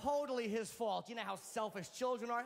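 ordinary delivery

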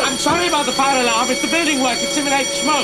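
loud apology over the alarm; over fire alarm. Acted. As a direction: shout the explanation over the noise.

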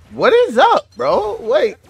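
sudden high yelp of disbelief; shocked, pitch jumping way up; startled outcry. Real, not acted. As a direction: caught off guard mid-fight. Sudden outburst at getting hit.

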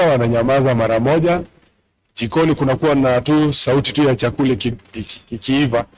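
ordinary talk

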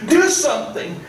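high, desperate mother's plea to the king; pleading in tears. Retold or imitated. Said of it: "runs up to the king in tears".

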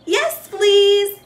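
high, loud eager yes; delighted. A narrator's reading. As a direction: burst out with delight.

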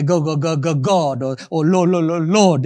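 mock stammer, repeating the first syllable; Moses stuttering. Preacher imitates Moses trying to say God and Lord, stammering. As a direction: get stuck on the word, then land it. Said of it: "He used to say like that".

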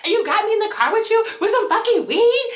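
high-pitched incredulous outrage; retold heated rant in a high voice; mad, indignant. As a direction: act out telling off a friend, each question flung upward. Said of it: "Like, I was so mad".

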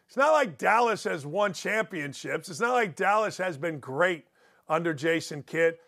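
ordinary delivery

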